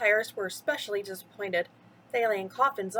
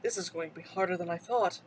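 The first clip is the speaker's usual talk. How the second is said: low, quiet, deflated; weary sigh; 6 semitones lower, 6 dB quieter than usual. Acted. Jack sighs to his crew, low and tired. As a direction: let it sink with a tired sigh. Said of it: "Jack said with a sigh".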